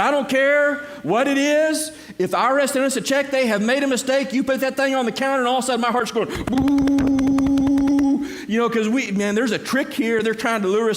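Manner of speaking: husband on the phone, panicking over the check; panicked alarm. Retold or imitated. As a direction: blurt it out in a panic. Panicked retelling of his reply.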